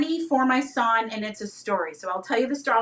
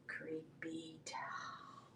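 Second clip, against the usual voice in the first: very slow, spooky whisper; creepy whisper; 8 semitones lower, 24 dB quieter, much slower than usual. Pretend. Seller shows off doll leggings, creepy whisper. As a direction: whisper it like a ghost story, drawn right out.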